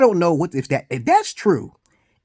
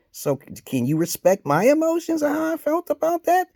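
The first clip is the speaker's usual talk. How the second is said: imagined retort; 8 semitones higher, 5 dB louder than usual. Retold or imitated. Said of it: "I said".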